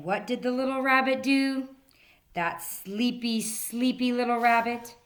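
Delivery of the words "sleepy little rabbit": ordinary delivery, nothing marked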